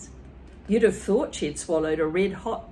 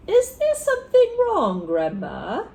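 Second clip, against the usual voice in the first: high, loud mock innocence; faux-innocent boy; innocently; 11 semitones higher, 6 dB louder than usual. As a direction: ask all innocent and sly.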